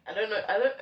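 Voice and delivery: awkward laugh through words. Real, not acted. Embarrassed vlogger laughing at herself after crying.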